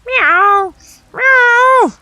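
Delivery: high, loud mewing cat; hungry cat crying at the door; cat meowing. A narrator's reading. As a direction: interrupt with a loud cat meow. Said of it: "interrupted Simpkin".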